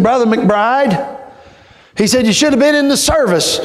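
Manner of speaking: lightly voiced old Brother Little; elderly preacher voice. Old preacher telling the young preacher.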